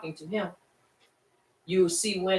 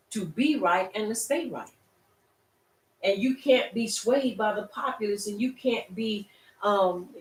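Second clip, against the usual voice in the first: higher, louder, driving sermon delivery; full-force preaching; 4 semitones higher, 4 dB louder than usual. Acted. Preacher urging the congregation to stand firm, fervent preaching. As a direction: preach it with rising fire.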